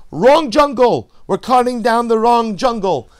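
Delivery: loud, high shout; yelling down. Acted. Leader on the treetop shouting down to workers, loud alarm. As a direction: bellow it from the treetop. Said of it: "He yells down".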